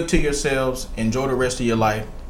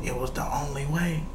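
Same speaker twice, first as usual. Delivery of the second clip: soft quote; 12 dB quieter than usual. Retold or imitated. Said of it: "He said".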